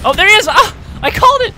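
high, loud triumphant yell; excited shout. Real, not acted. Gamer spots the enemy he predicted, excited shout. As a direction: burst out loudly, high-pitched. Enemy appears where he guessed.

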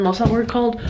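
ordinary talk

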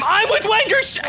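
high, slow shouted announcement; shouting. Acted. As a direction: belt it out to the room. Calling out again, louder.

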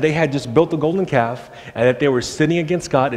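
usual speaking voice

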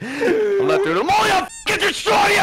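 high, strained shouting; frustrated outburst. Real, not acted. Player protesting to friends while losing, frustrated outburst. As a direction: explode at the game mid-protest.

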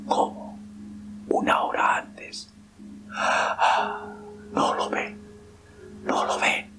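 slow hollow whispered dread; cavernous murmur. Acted. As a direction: murmur it dully, as if entranced. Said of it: "murmuró apagadamente con voz cavernosa".

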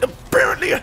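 high crying shout over the goal; mock wail. Real, not acted. Gamer wailing as his team goes down. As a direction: wail like it's the end.

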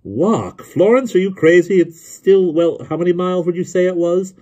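loud, high-pitched disbelief; incredulous protest. A narrator's reading. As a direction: question it as if it were madness. Jonathan protests the idea.

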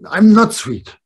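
indignant grandchild. Retold or imitated. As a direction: push back loudly like a cross little child. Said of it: "I met today my grandchild".

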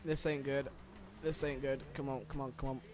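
low, soft repeated mutter, fading; worried muttering. Real, not acted. Player to self as race goes wrong, worried mutter. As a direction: talk yourself through trouble under your breath.

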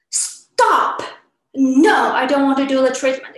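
loud, sharp "Stop!" then a fast refusal; acted anger. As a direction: firmly cut the dentist off. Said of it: "quickly with anger".

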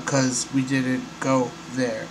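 slow, soft, measured quote with gaps; robotic. Retold or imitated. Host mimicking the astronaut's reworded answer, robotic and halting. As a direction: say it word by word like a robot. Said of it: "say it in a different way".

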